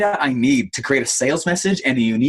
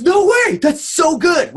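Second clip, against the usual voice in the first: much higher, louder, amazed; stunned disbelief; 4 dB louder, slower than usual. Retold or imitated. Investor hearing the trial cost, amazed. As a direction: act floored by the number. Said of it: "And he goes".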